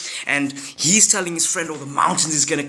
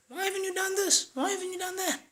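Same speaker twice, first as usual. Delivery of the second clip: high, nagging wife voice; 7 dB quieter, faster than usual. Acted. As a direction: scold him in a high put-on voice.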